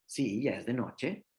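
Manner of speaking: unhurried affirming parent; calm parent reply. Retold or imitated. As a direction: gently confirm it for the child. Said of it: "los niños de que ven afuera".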